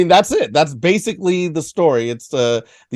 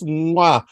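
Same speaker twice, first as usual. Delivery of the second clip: kiss the air with a big "mwah"; chef's kiss; 2 semitones higher than usual. Acted. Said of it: "chef's kiss".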